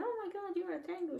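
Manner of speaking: high, startled gasp of a line; a girl suddenly meeting a tengu, shocked; surprised. Acted. Girl meeting a tengu for the first time, surprised. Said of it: "she's surprised".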